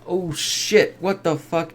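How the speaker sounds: high, startled outburst at the screen; shocked oh shit. Real, not acted. Viewer reacting to a cartoon attack, startled. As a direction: jump up in alarm at the twist. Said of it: "It's like".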